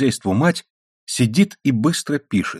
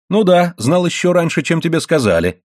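higher-pitched needling retort; sarcastic jibe; 7 semitones higher than usual. A narrator's reading. Wife sniping at her husband, sarcastic. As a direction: needle him with mock agreement. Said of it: "съязвила жена".

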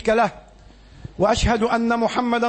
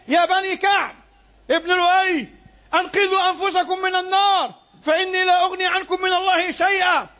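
raised, higher, urgent calling out to the clans; preaching at full force; 8 semitones higher than usual. Retold or imitated. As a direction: sound the alarm like a warner from the hilltop. Prophet's warning call retold. (نداء تحذير بصوت عالٍ حماسي)

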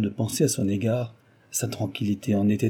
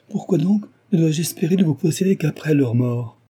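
higher, louder pleading question; impassioned protest; 7 semitones higher, 5 dB louder than usual. A narrator's reading. Suitor pleads with Cécile to marry now, impassioned.

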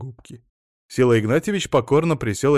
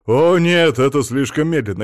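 loud, raised objection; emphatic protest; 4 semitones higher, 8 dB louder than usual. A narrator's reading. Husband rejecting the train for his guests, emphatic. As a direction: burst out in dismay.

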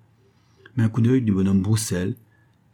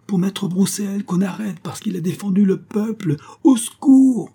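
piercing, very high calls for help; frantic high cries to the street; crying out harder; 12 semitones higher, 5 dB louder than usual. A narrator's reading. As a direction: raise the alarm at the top of your pitch. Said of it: "Elle se mit à crier de plus belle".

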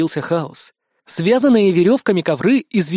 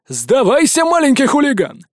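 commanding guard; 7 semitones higher, 3 dB louder than usual. A narrator's reading. Guards order surrender.

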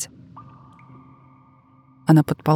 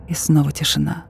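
ordinary delivery, nothing marked

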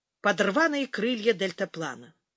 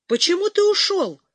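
much higher, loud shout through a door; 9 semitones higher, 6 dB louder than usual. A narrator's reading. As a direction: yell after him in angry disbelief. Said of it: "кричала пленница из-за двери".

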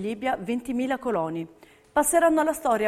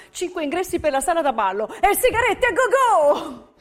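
excited build; 7 semitones higher, 5 dB louder than usual. Real, not acted. Listing the lavish welcome gifts.

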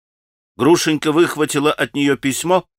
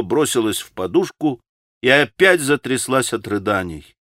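ordinary delivery

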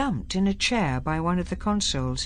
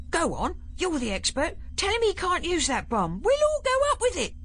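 higher and rising, alarmed; urgent pleading; 13 semitones higher than usual. A narrator's reading. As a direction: push him, rising alarm. Ben urgently pleading with Barclay.

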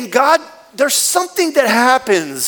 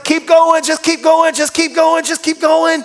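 higher, louder, pushing cheer; mock coach shout; 7 semitones higher, 4 dB louder than usual. Test staff urging the runner on, shouting higher. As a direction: egg them on like a treadmill coach. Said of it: "and they're like".

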